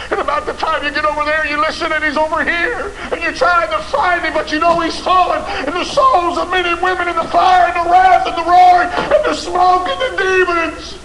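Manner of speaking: high, loud delivery building to a shout; full-force preaching. Acted. Preacher describing a father's frantic search, full-force preaching.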